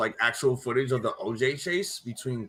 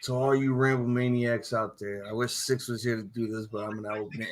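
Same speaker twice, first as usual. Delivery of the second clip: ordinary delivery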